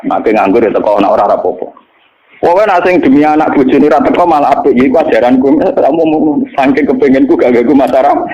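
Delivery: ordinary delivery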